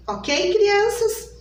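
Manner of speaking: normal talking voice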